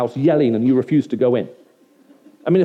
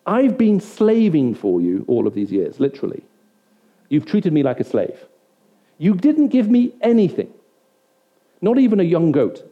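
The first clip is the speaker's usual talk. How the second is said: indignant, raised-pitch grievance in heavy emphatic bursts; angry complaint; 4 semitones higher, 3 dB louder than usual. Acted. Older son refusing to go in, protesting angrily to his father. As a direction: act out a son's bitter protest. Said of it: "The older son is that mad".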